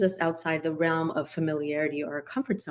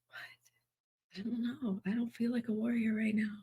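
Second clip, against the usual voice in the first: whispered, softer disbelieving thought; doubtful whisper; 5 semitones higher, 10 dB quieter than usual. Retold or imitated. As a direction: breathe it out under your breath, unsure. Doubting thought after heart speaks.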